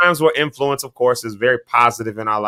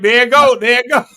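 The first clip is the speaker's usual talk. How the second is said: high, loud, gleeful callout; rising excited heckle; excited teasing; 10 semitones higher, 5 dB louder than usual. Real, not acted. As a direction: point it out like trouble is coming. Teasing alarm at Rhonda's look.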